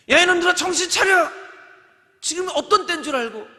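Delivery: loud, high scolding yell; furious elder yelling at partygoers; angry shout. Pretend. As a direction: rebuke careless youths. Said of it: "이렇게 얘기를 해야죠".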